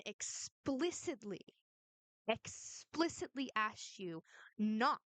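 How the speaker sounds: "explicitly" stretched slowly syllable by syllable; emphatic, drawn out. Real, not acted. Streamer reminding critic of Jen's refusal, "explicitly" slow and emphatic. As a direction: lean slowly and hard on "explicitly" and "not". Stresses that Jen said no.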